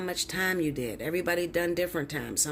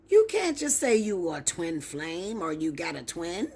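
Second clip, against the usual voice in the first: ordinary talk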